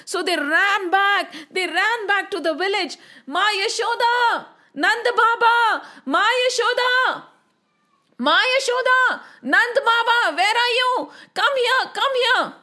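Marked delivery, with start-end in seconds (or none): 3.3-12.5 put-on high-pitched, urgent children's calls; calling out. A narrator's reading. Children run back to the village for help, calling out. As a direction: call for the grown-ups in alarm. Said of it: "Why are you shouting".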